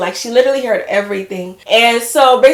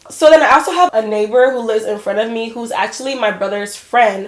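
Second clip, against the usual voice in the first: ordinary talk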